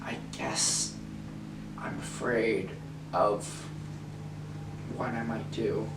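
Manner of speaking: hushed and breathless, barely voiced; a frightened, barely voiced admission; fearful hush. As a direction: confess it in a scared near-whisper. Confessing fear to the director.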